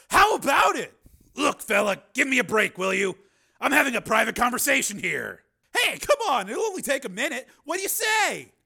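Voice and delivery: a nosy fan barging in; pushy fan. Acted. Bar patron interrupting Steele, pushy.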